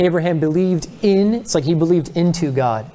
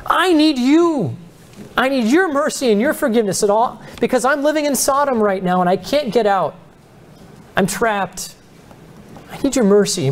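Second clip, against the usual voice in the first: pleading prayer; 6 semitones higher than usual. Acted. Voicing a desperate believer's prayer.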